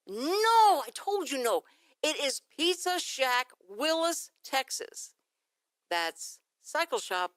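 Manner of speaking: shouted No then slow angry spelling-out; angry insistence. Retold or imitated. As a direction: hammer each word at the machine. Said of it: "But I said".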